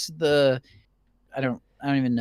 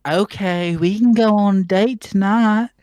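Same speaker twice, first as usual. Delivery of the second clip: high, loud Southern belle voice; 7 semitones higher, 6 dB louder than usual. Acted. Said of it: "I made Rouge a Southern Belle".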